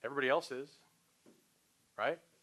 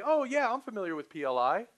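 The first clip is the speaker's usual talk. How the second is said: higher, louder mock prospect; prospect's glib claim; 7 semitones higher, 6 dB louder than usual. Prospect claiming to know the company, glib. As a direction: pipe up like a prospect who supposedly knows you.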